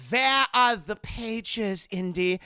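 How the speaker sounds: put-on slow, deliberate German-accented demand. Elsa interrogates Indy, stern German accent. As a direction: stretch each word in a German accent.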